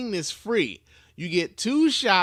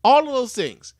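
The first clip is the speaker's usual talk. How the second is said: loud emphatic; 7 semitones higher, 9 dB louder than usual. Real, not acted. Ranting about treatment costs.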